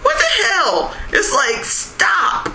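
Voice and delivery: high, incredulous outburst; exasperated shout. Retold or imitated. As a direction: cry out in disbelief, then beg stop. Mock outrage at endless remakes.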